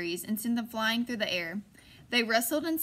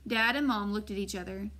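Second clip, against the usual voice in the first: plain reading voice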